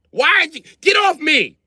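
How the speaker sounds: high, loud annoyed yell. Retold or imitated. Dad waking up under his daughters, annoyed yell. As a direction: shove them off, yelling. Kids sitting on his head.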